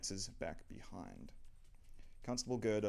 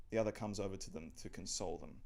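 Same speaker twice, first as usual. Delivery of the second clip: usual speaking voice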